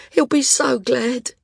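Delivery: high, slow, tearful gladness; through tears. A narrator's reading. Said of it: "kept saying through her tears".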